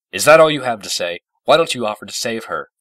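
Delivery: loud, high, angry accusation; exploding with rage. A narrator's reading. Discord challenging the princess to save Fluttershy, enraged. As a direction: explode at her, loudly. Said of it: "Discord exploded with rage".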